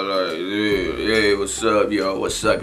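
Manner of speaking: low, slow too-cool greeting; drawn-out, low laid-back mock greeting; acting all cool. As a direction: imitate a friend playing it cool. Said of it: "trying to act all cool".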